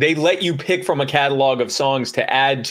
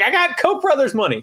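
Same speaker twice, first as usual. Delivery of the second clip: gleeful brag; 13 semitones higher than usual. Retold or imitated. Proud mock brag, holding check.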